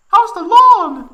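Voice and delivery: very high, loud, slow sweet question; sweet falsetto. Acted. Tifa asking Cloud about the lawn, sweet falsetto. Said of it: "asked Tifa sweetly".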